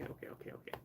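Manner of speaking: fading to a whisper. Real, not acted. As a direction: soothe the cat in a whisper. Fading soothing words.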